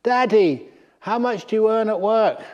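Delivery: put-on high, loud, insistent little boy; pestering child. Little son nags his tired father, high insistent child voice. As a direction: whine it up high like a small child.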